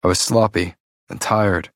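ordinary delivery

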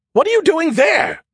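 very high, loud disbelieving shriek; disbelieving screech. A narrator's reading. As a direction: screech it in shock. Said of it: "His voice was a disbelieving screech".

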